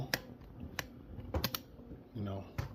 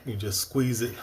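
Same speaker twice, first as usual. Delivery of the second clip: ordinary talk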